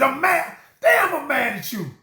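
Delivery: loud, high angry outburst with a laugh; showing anger. Shown as a demonstration. To a spouse, showing the anger: loud angry outburst. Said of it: "Now notice I didn't show, if I said".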